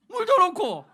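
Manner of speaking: high, loud, offhand mom's answer; mock mother. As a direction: fling the answer like it's obvious. 어머니 대답 흉내. (당연하다는 듯 큰 소리로 대답하는 어머니)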